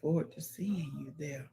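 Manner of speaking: ordinary delivery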